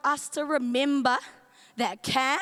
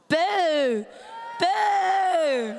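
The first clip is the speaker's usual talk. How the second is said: long, drawn-out boos, the second higher; hammed-up boo; playful booing; 5 semitones higher than usual. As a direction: boo it like a pantomime crowd. Booing the end of camp.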